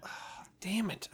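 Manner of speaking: hushed, slow muttered curse; whispered curse. Real, not acted. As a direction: breathe the curse under your breath.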